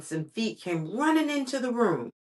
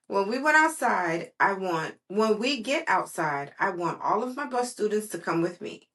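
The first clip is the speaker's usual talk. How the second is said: plain reading voice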